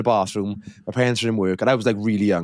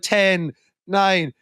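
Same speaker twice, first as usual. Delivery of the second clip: higher-pitched, spaced-out counting; dramatic countdown; 6 semitones higher than usual. Retold or imitated. Older sister counting down her brother's last seconds, mock suspense. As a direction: count down as if to doom, imitated.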